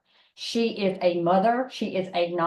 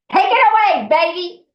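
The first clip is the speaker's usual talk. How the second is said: much higher and louder, big hype call; excited shout; 12 semitones higher, 11 dB louder than usual. Real, not acted. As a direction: hand over the stage with a whoop. Handing over the stage to friend.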